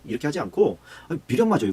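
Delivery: ordinary talk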